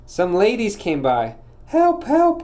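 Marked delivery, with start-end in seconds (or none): 1.7-2.4 much higher, panicky cry; cry for help. A narrator's reading. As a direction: squeal for help in a fright. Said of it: "cried the old mouse".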